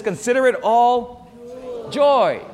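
consider lifted high and loud; ringing proclamation. Retold or imitated. Pastor proclaiming the verse to the congregation. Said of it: "He says".

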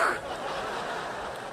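breathy chuckle at the punchline; quick silent laugh; soft unvoiced laugh. Real, not acted. Preacher laughing softly at his own punchline.

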